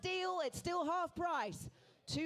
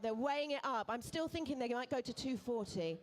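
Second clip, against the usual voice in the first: normal talking voice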